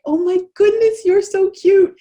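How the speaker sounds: put-on high, loud cooing over a baby; gushing stranger. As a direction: squeal with delight at an infant. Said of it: "they pick them up and say".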